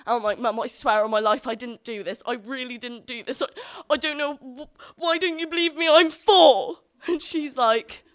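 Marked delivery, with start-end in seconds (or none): 0.4-6.8 higher, louder tearful begging; retold crying plea. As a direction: beg mum through mock tears. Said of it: "I'm crying at this point".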